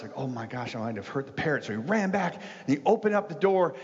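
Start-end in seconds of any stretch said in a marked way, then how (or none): 0.2-1.6 quiet, worried aside; a hushed, anxious realization; mildly nervous, softer voice; nervous worry. Retold or imitated. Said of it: "The man got kind of nervous".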